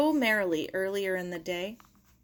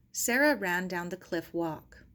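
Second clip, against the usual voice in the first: ordinary delivery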